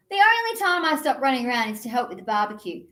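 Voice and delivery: ordinary delivery, nothing marked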